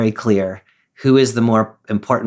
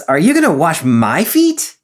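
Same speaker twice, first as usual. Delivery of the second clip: a touch upset and offended, slowed; 7 semitones higher, 4 dB louder than usual. Shown as a demonstration. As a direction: ask it a little offended and slow. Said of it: "like, upset or offended".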